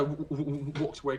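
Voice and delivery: usual speaking voice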